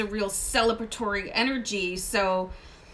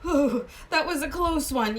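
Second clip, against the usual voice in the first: imitating a relieved laugh, a bit higher; 5 semitones higher than usual. Viewer who just dodged trouble, mock relieved laugh. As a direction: laugh it off like a narrow escape. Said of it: "and, like".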